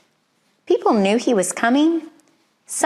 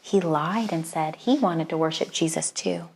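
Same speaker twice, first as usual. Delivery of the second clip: ordinary delivery, nothing marked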